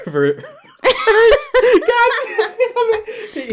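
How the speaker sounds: high laughing shout; cracking up in exasperation; laughing frustration. Real, not acted. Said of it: "fumbled the word again".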